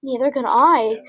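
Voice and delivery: plain reading voice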